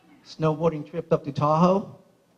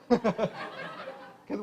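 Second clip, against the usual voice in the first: slow words broken by laughter; chuckling, slowed-down words; laughing; 3 semitones higher, 8 dB quieter than usual. Real, not acted. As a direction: talk through your own laughing fit. Laughing through his own joke.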